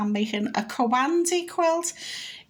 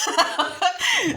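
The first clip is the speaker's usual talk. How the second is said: giggle; 8 semitones higher than usual. Real, not acted. Laughs at own French attempt.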